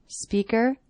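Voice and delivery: "Speaker" with pitch rising at the end. Shown as a demonstration. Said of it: "my voice goes up at the end".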